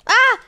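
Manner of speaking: sharp high cry of fright; startled scream. Real, not acted. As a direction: yelp as the mob hits. Sudden attack in dark cave.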